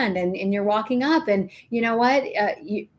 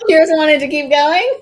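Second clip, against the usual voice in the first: laughing question; 7 semitones higher, 8 dB louder than usual. Real, not acted. Laughing at co-host's story.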